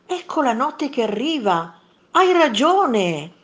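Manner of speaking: high, loud, stretched-out agreement; delighted realisation. A narrator's reading. Monster delightedly concedes the point. (meravigliato, dà ragione)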